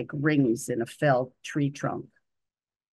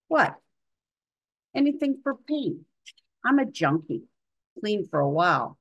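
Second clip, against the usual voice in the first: patient's voice; 7 semitones higher than usual. Acted.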